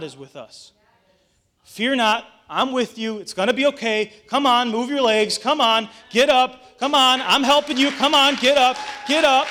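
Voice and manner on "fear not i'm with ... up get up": rally someone who has fallen, louder and louder; urgent encouragement. Acted. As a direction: build from firm reassurance to loud, raised urging. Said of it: "That's God's way of comforting".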